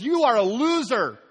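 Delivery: mother-in-law berating the son-in-law, high and scornful. Retold or imitated. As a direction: sneer at the loser son-in-law.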